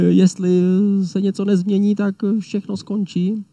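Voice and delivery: high, mildly anxious worry; a touch of imitated dread; fearful inner voice. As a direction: voice the panic lightly, as a thought. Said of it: "kdy si říkáme".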